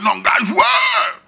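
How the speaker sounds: put-on shout, high and slow, calling the boys; cri moqueur. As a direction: stretch it out like a street call. Gars interpellés au quartier. (appel crié, lent et aigu)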